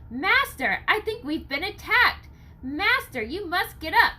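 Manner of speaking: high, anxious pleading; urgent plea. Acted. Nathaniel pleads with Basil to wake, high and anxious. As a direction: push him to wake, worried. Urging master awake.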